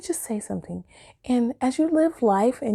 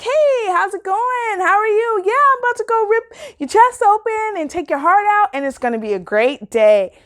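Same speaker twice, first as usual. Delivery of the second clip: bubbly surgeon; 12 semitones higher, 4 dB louder than usual. Retold or imitated. Mocking a bubbly surgeon, higher and cheery. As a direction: play a bubbly doctor, sing-song sweet. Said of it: "a bubbly surgeon out there like".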